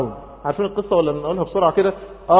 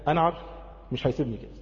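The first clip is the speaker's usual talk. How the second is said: soft, lowered voice; quiet trust; 2 semitones lower, 10 dB quieter, faster than usual. Acted. Moses trusting his Lord at the sea, quiet trust. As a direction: confide it with calm certainty.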